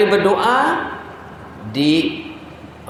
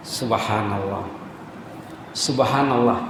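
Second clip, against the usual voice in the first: hushed awe; 7 semitones lower, 7 dB quieter than usual. Real, not acted. Awed exclamation after story.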